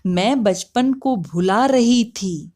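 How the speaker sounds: slow, lilting Hindi poetry recital; poem recitation. Acted. Mother shares a poem about her lost childhood, lilting recitation. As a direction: recite it in drawn-out, rising-and-falling verse cadence.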